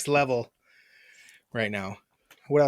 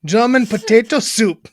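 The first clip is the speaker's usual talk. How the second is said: firmer mock German; German accent; 3 semitones higher than usual. Shown as a demonstration. Speaker homes in on it, firm German accent. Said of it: "There it is".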